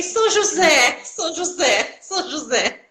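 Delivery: high acclaim settling into a laugh; mock-reverent cry, pitch stepping down each time; playful exclamation. Patient hailing physiotherapist as a saint, playfully exultant.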